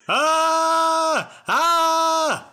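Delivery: loud, high panicked yell; alarm shout. Acted. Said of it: "I start yelling".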